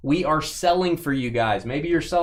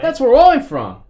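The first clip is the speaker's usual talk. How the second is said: loud mock Aussie; 11 semitones higher, 8 dB louder than usual. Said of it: "He's an Aussie".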